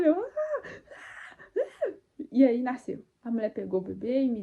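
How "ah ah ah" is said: very high crying screams of labor; screaming. Retold or imitated. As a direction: scream as the head comes down. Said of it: "mais eu gritava".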